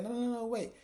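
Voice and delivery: a high, frantic plea to wait; pleading. Retold or imitated. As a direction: beg her to stay, quickly. Retelling him begging her to stay.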